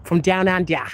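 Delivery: broad attempt at an Australian accent. Shown as a demonstration. Streamer practising a requested accent, attempted Australian accent. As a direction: try the Aussie vowels, ending "under" on an open "ah". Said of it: "how do you do an Australian accent".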